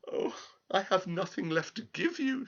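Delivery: high, despairing cry; despairing girl. Acted. Girl despairs to the little man. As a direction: plead with nothing left. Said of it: "she cried".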